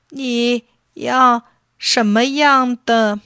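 hesitant, uncertain. A narrator's reading. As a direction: draw each word out, unsure what to do. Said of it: "含含糊糊地说". (迟疑地慢慢问)

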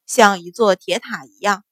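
ordinary delivery

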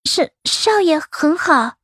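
high, slow, timid maid's reply; timid, cowering. A narrator's reading. Concubine answers the old mistress, timid and cowering. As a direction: answer haltingly, shrinking back. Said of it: "茯苓畏畏缩缩地说着".

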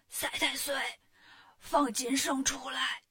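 voiceless, strained hoarse call; hoarse shouting. A narrator's reading. Wukong calling at the demon's gate after a night of shouting, hoarse. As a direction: try to shout with the voice worn out. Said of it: "实在是喊不出声了".

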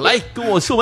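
put-on high, bright little kid calling to play. Retelling the anime, the football kid calls him over in a high kid voice. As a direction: voice the eager boy inviting him to shoot. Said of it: "你说".

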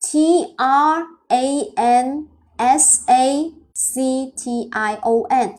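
T R A N S A C T I O N drawn out letter by letter; slow spelling. Shown as a demonstration. Teacher spells transaction again for students, very slowly. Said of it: "我们再again再来说一遍".

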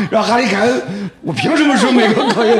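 retelling the promo with real laughter; laughing indignant quote. Retold or imitated. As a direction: play Kane's "why should I" while cracking up.

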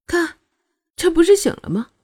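high-pitched, irritated retort; huffy. A narrator's reading. Shuangyue pointing out that the girl woke, huffy. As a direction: snap it out with no patience. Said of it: "又没好气儿说道".

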